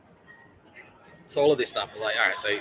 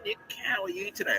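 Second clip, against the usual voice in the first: much higher, sugary fake-polite greeting; mock sweet sister; 14 semitones higher, 5 dB louder than usual. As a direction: play her overly nice hello. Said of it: "they bring in the big guns".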